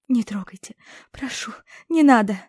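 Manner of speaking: usual speaking voice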